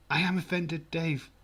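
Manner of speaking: mock offended. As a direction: act theatrically offended. Jokingly claims offence at listener.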